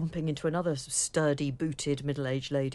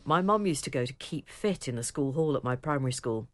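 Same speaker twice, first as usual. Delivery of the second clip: ordinary talk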